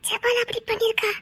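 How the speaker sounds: hushed. Acted. Soft intimate exchange.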